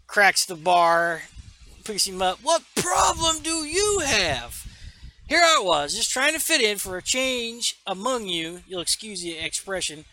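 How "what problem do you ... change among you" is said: acted fed-up bellow; angry outburst. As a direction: explode at the whole room, pitch way up. Aquaman finally snaps at the bar.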